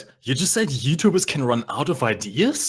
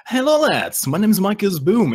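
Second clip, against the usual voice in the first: higher, louder hello to the lads; hyped greeting; 7 semitones higher, 4 dB louder than usual. Pretend. Showy greeting to viewers.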